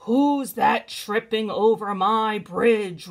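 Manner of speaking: loud, slow troll; roaring troll. A narrator's reading. Troll challenging the second goat, loud slow roar. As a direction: roar it slowly, drawing out the words. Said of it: "roared the troll".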